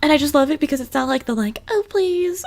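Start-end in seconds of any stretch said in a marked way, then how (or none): 1.7-2.4 "Oh, please," squeaked much higher; mock intern. Retelling the intern's line, high mocking squeal. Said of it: "or however she says".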